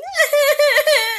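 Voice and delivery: high shrieking laugh with tears; laughing-crying. Real, not acted. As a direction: break into teary laughter.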